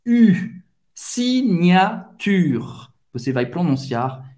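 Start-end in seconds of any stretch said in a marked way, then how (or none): 0.1-0.3 lips rounded as for O while saying I; French u sound. Shown as a demonstration. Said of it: "faz a boca do O e fala I". 0.9-2.6 Signature said slowly, ends on R, no final e; slow, drop the final e; silent final e. Shown as a demonstration. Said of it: "o E é mudo no final".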